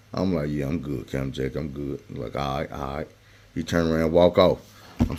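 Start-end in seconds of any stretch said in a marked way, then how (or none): 0.5-2.0 lower, much softer casual reply; innocent reply. Retold or imitated. Hiding bracelet from the guard. 2.4-3.1 lower, much softer casual reply; innocent reply. Retold or imitated. Hiding bracelet from the guard.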